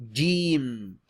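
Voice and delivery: Jeem said loud, long ee, closing on m; teacher modelling Jeem; Arabic letter Jeem. Shown as a demonstration. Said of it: "Repeat after me".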